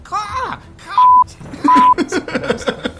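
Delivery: high loud screeching caw; shrill screeching bird woman. Acted. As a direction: screech it like an angry crow. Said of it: "it just goes".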